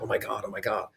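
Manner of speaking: very soft retold excitement; excited under your breath, lightly acted; quietly replaying a thrilled reaction; hushed excitement. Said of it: "all excited".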